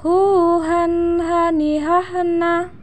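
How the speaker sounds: drawn-out recitation drill; swung, held syllables. Shown as a demonstration. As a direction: stretch the vowels and hold the nasals. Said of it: "ayu ayun mana tahan".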